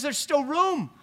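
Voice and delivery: generous master, rising with zeal; eager host. Retold or imitated. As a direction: insist the house be filled. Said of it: "he says".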